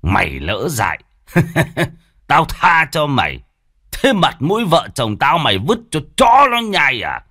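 sneer, then explode; enraged. Acted. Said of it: "càng nổi trận lôi đình".

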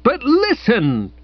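slow, high plea with little extra force; mild acted yell. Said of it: "I yelled".

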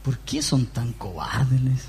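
low, slow, gentle rebuke; mock-sweet question from the Lord to his frightened disciples; sweetly. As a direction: ask it softly, like a tender teacher. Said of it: "le dice el Señor dulcemente".

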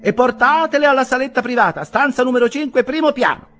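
high, loud orders rattled off; bossy landlady. A narrator's reading. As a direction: order the servant about. (la locandiera dà ordini)

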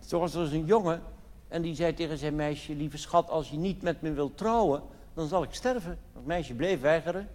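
ordinary delivery

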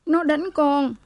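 high-pitched, acted weeping complaint; mock-crying wronged daughter; tearful outburst. A narrator's reading. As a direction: blurt it out high, as if bursting into tears. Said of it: "Cô Lễ òa lên khóc".

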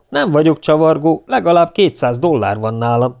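protesting. A narrator's reading. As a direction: defend yourself against the charge. (tiltakozó, bizonygató hang)

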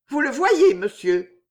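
high, loud start, dropping on the last word; suppressed nervousness. A narrator's reading. Jeanne answering monsieur d'Étiolles, mastering her nerves. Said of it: "cherchant à dompter le tremblement nerveux".

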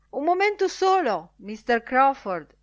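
high, loud interjection; calling out politely. A narrator's reading. Bloom asking the editor for a moment, calling out. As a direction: pipe up to catch his attention.

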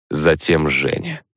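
plain reading voice

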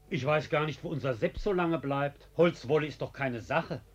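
normal talking voice

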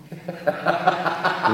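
loud mocking laugh; scornful laugh. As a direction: laugh her excuse off. Officer laughs at her excuse. (höhnisches Lachen)

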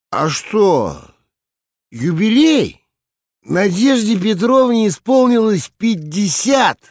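put-on drunk voice. A narrator's reading. Drunk director rambles at assembly.

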